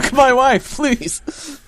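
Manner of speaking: high silly pleading voice; mock pleading. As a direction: beg it in a squeaky voice. Mocking the ad, high pitched.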